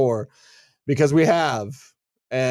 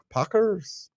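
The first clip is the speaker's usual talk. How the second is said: higher, softer botched Wisconsin "Packers"; attempted Wisconsin accent; 6 semitones higher, 5 dB quieter than usual. Shown as a demonstration. Host trying again for co-host, unsure Wisconsin accent. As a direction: fumble at a Wisconsin accent, questioning. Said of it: "say it like a Wisconsinite".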